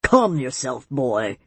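low, restrained snap from an elder; snapped. A narrator's reading. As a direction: curtly rein in an excitable nephew, voice dropping. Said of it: "the elder snapped".

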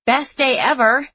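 loud, slow happy yelp; excited. A narrator's reading. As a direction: burst out with pure delight. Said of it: "let out a happy yelp and said".

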